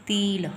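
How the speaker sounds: तिल held long as teel; stressed long ee. Shown as a demonstration. Teacher showing what extra stress makes, stressed long ee. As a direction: lean on a drawn-out ee in तिल. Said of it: "हम ज़्यादा stress देंगे ई की पर".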